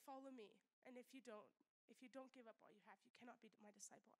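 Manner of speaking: quietly urgent plea; hushed intensity. Real, not acted. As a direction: drop to an urgent hush.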